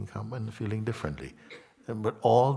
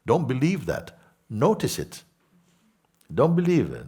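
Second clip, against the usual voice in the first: louder and higher, BELIEVE hit hard with a falling tune; emphatic warning; 7 semitones higher, 6 dB louder than usual. Real, not acted. Teacher urging listeners not to believe, loud and emphatic. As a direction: stamp on "believe" each time.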